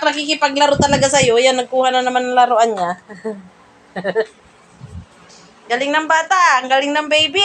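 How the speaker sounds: ordinary talk, nothing marked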